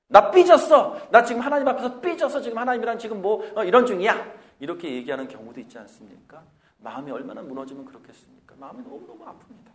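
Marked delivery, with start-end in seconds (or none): 0.1-4.6 put-on loud high childish pouting; a believer sulking at God in prayer. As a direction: whine that you're sulking at God. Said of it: "그러면서 이런 말을 합니다".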